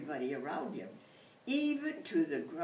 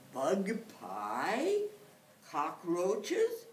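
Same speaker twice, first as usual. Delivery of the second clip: dismayed surprise; 3 semitones higher, 7 dB louder, much slower than usual. Acted. Animals dismayed.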